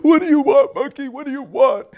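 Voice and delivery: high, loud mock wail; loud crying lion. Crying lion answers Monkey at his cave, loud high wail. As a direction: wail it as an upset lion king. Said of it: "he's crying and he's loud".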